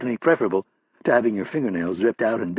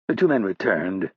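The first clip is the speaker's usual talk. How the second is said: plain reading voice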